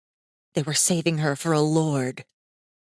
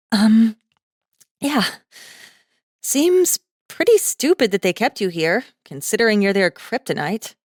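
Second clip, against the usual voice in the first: put-on higher, louder young woman, hesitant; awkward girl; 4 semitones higher, 4 dB louder than usual. Girl telling Masaaki he's their kryptonite, hesitant and high. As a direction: answer with an awkward gulp.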